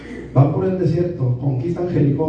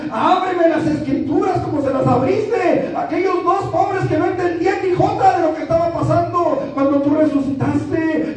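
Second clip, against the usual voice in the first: high, rising pleading prayer; impassioned prayer; 10 semitones higher than usual. Acted. Preacher begging the Lord to open the Scriptures, fervent prayer. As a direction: cry out to God with mounting fervor.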